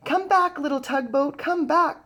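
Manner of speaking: high, loud call after the boat; crying out. Acted. Little boy calling after his tugboat, loud and high. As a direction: call him back across the water. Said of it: "cried the little boy".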